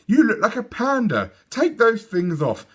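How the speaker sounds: high, stern mother telling off; firm motherly rebuke; scolding. A narrator's reading.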